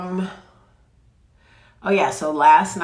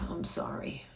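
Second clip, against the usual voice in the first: whisper; 14 dB quieter than usual. Real, not acted. Apologizing quietly to the dog.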